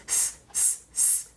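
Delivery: long unvoiced hiss, s s s; hissing s sound. Shown as a demonstration. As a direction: hiss the s like a sizzling sausage. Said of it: "Do that with me".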